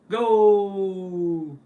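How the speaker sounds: long, high, loud commentator-style cry; goal shout. Pretend. Streamer playing at a football goal call, drawn-out loud shout. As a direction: hold the word out in a soaring yell.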